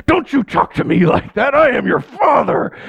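hammed-up fatherly rebuke; stern father. A father scolding his mouthy kid, mock stern. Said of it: "No, I'm like".

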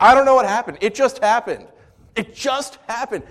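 high-pitched mock helpless teenager; hammed-up excuse from young people. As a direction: plead innocence, comically high.

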